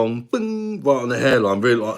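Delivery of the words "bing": high-pitched "bing" sound effect; imitated ping. Shown as a demonstration. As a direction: ring out a sudden high bing. Imitates bright light switching on.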